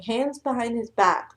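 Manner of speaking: usual speaking voice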